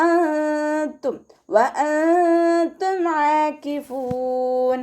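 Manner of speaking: ആകിഫൂൻ chanted slowly, ع from mid-throat; tajweed recitation. Shown as a demonstration. Teacher demonstrates the verse slowly for students. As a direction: recite അൻതും with hidden nasal, slow high chant. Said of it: "അതിൻ്റെ സൗണ്ടിനെ തൊണ്ടയുടെ മധ്യഭാഗത്തു".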